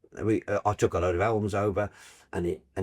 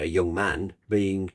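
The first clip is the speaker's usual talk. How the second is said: ordinary talk, nothing marked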